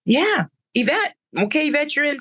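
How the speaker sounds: high, bright greeting; welcoming her back with excitement; excited. Real, not acted. Excited greeting of returning guest.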